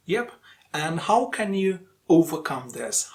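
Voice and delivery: ordinary talk, nothing marked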